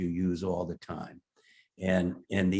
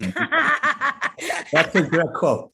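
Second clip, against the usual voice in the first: laughing through the words, much higher; 12 semitones higher, 8 dB louder, much slower than usual. Real, not acted. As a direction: still cracking up at the joke. Retelling a friend's joke about God.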